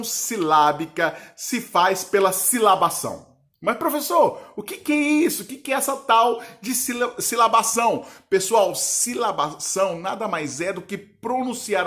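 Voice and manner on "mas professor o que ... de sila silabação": high-pitched puzzled student questioning the teacher; raised, questioning pupil voice. Acted. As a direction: pipe up higher, like a baffled pupil.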